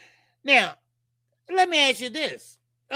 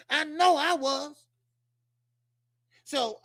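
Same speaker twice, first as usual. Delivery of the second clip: normal talking voice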